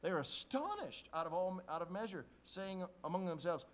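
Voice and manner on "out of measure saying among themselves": usual speaking voice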